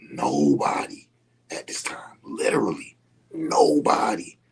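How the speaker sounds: breaking into a tearful whisper; choked whisper. Real, not acted. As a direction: voice gives out to a near-silent cry. Tearful recalling being totally alone.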